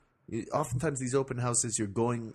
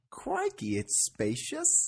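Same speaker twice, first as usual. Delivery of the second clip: high, drawn-out mock Aussie squeal; Australian girlfriend; much slower than usual. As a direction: gush slowly in a hammed-up Aussie accent. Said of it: "So I'm playing your girlfriend here".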